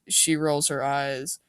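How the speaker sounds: ordinary delivery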